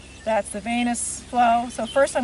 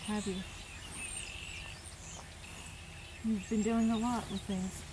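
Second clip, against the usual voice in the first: softer, gentle voice calming the dog; soft, soothing; 11 dB quieter than usual. Real, not acted. Healer to dog during treatment, softly soothing.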